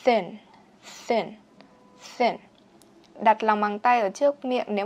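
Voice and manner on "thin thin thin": "Thin" with tongue between the teeth and a puff of air; th with breath pushed out. Shown as a demonstration. Teacher to class: tongue between teeth, breath pushed out on "Thin". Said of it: "Sau đó bật hơi ra".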